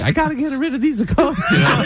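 high-pitched fake Italian accent. Coat seller unloading stock from his trunk, fake Italian accent. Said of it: "did it with a fake Italian accent".

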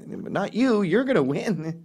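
mock sheepish reassurance. Reassuring the lottery player after the gaffe, sheepish. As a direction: backpedal awkwardly to cover the gaffe. Backpedals to the man.

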